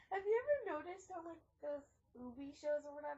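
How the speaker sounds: laughing. Real, not acted. Laughing fast aside.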